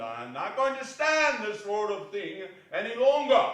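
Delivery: mock stern. Scrooge feigns scolding Bob.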